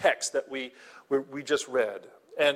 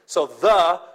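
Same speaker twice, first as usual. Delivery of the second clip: "the" said much louder; stress on the; 2 semitones higher, 9 dB louder than usual. Shown as a demonstration. Pastor teaching the congregation, hits "the" loudly. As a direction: punch "the" hard as the definite article. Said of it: "is the definite article".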